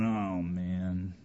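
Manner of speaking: low, soft reluctant groan over the wallet; a sorry sigh of a stingy giver. Retold or imitated. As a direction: sag at the cost. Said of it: "looking at our wallet going".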